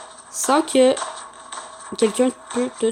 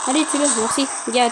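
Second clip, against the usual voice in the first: normal talking voice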